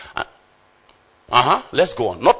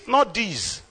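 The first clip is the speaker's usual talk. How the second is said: ordinary delivery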